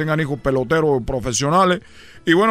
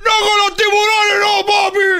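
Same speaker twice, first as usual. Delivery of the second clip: hammed-up, very high panicked child; screamed begging; 7 dB louder, slower than usual. Boy begging not to be thrown to sharks, comic screamed plea. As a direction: shriek the plea at full volume. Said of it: "¿por qué estás gritando?".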